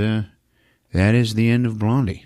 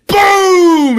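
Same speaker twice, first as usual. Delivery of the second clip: loud, high, drawn-out boom; big stretched explosion imitation; explosion noise; 7 dB louder than usual. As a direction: shout the blast as a sound effect. Mimics explosion.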